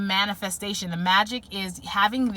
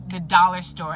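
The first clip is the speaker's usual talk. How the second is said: normal talking voice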